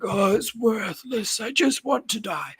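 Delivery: put-on high, slow, despairing old prisoner; mock frail king, hammed-up. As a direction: whimper it like a broken captive. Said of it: "he's like".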